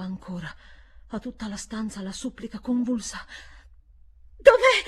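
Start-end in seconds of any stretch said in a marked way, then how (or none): 4.4-4.8 desperate cry. Acted. Miles desperate.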